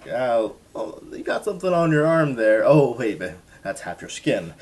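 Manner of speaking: high, loud, hammed-up doctor voice; mock doctor. Doctor spotting the skin on his arm, hammed-up and loud. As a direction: play the doctor cheerfully unbothered, overdone.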